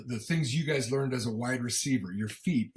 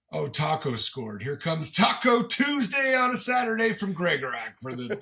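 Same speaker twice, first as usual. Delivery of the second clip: mocking annoyed fan; 7 semitones higher, 4 dB louder than usual. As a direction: sneer like a fed-up viewer. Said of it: "some people that are like".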